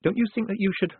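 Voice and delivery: high, carefully reasonable suggestion; trying to sound reasonable. Acted. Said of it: "She tried to sound reasonable".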